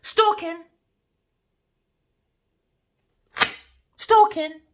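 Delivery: high, loud single word; shouted accusation. Real, not acted. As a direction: yell the charge out.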